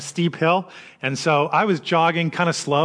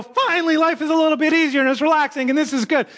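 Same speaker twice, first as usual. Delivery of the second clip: relieved vacation glee; 12 semitones higher, faster than usual. Pretend. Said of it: "We go".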